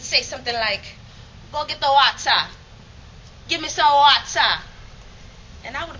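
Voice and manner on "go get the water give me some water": louder, lightly West Indian grandmother ordering water; West Indian accent. Retold or imitated. Grandmother ordering the girl to fetch water, in a West Indian accent. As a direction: West Indian lilt, bossy and loud. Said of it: "spoke with such a heavy West Indian accent".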